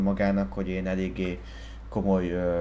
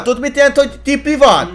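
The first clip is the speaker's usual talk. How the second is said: furious, much higher and louder yell; angry shouting; 12 dB louder than usual. Acted. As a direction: scream it out in a rage. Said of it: "Nem ordibálsz".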